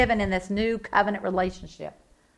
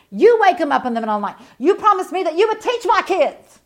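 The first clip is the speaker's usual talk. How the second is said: faster, louder, then higher and louder; preacher pressing God hard on a promise; rising preaching; 6 semitones higher, 6 dB louder than usual. Real, not acted. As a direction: build the plea to full force. Preaching builds to a climax.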